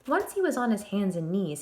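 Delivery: ordinary delivery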